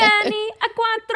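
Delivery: high, slow hammed-up Italian accent; slow, high mock-Italian drawl on the name. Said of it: "say it with Italian accent".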